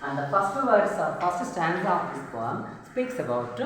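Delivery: normal talking voice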